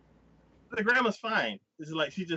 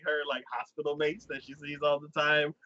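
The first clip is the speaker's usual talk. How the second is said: ordinary delivery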